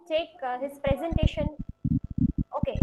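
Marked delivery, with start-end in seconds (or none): none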